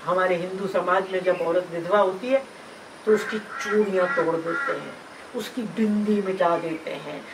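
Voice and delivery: ordinary talk, nothing marked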